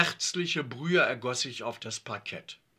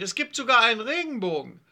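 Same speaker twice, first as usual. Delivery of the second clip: high, quick and loud; excited calling; 8 semitones higher, 7 dB louder, faster than usual. A narrator's reading. Yvonne spots a rainbow, excited quick call. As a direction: announce a marvel to your friends. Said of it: "rief Yvonne".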